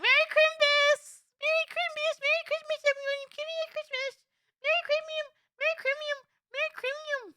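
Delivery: very high cartoon squeak; squeaky high voice. Squeaky Christmas greeting voice.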